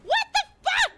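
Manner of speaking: high, loud outburst; exasperated yell. Real, not acted. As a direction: yell it in disbelief. Outburst over the game.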